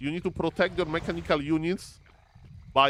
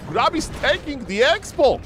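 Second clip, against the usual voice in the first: excited call; 9 semitones higher, 6 dB louder than usual. Real, not acted. Caster calls a big play.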